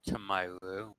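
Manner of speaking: normal talking voice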